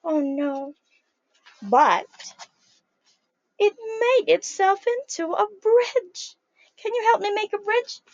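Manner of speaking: ordinary delivery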